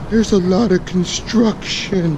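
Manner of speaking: high, slow cabbie impression; lightly mock the grumpy cab driver; imitating the cabbie.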